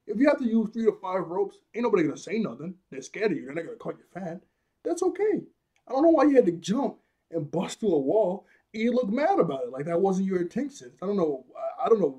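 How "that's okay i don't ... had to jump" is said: squeaky mock reassurance; high-pitched sarcasm. Real, not acted. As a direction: say it sky-high and teasing.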